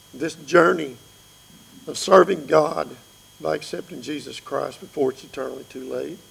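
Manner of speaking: ordinary talk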